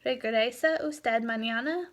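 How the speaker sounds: "¿Regresa usted mañana?" with a rise on "mañana"; question intonation. Shown as a demonstration. Teacher quizzing students by intonation, "mañana" rising as a question. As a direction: lift the end of "mañana" to ask. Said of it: "That is a question".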